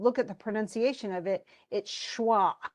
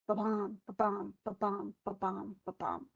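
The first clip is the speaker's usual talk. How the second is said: soft, steady "ba-bum" pulse, stress on BUM; heartbeat rhythm; 7 dB quieter than usual. Shown as a demonstration. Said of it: "like a heartbeat".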